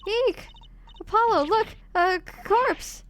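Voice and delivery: high, hammed-up frightened squeal; acted panic. Girl showing Apollo a corpse, panicked. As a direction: stammer in mock horror at a body.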